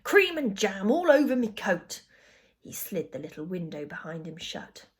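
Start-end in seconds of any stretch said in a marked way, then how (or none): 0.0-2.0 loud, higher-pitched grumble; indignant cabbie's moan; bitter. A narrator's reading. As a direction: complain bitterly and forcefully. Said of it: "he said bitterly".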